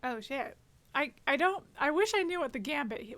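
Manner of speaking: normal talking voice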